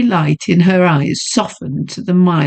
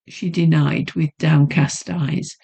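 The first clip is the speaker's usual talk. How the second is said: usual speaking voice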